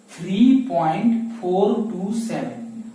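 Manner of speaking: slow, deliberate "three point four टू seven", each digit spaced; slow teacher's reading aloud; slow model reading. Shown as a demonstration. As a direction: spell the number out slowly for the class. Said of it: "तो इसे पढ़ेंगे हम लोग".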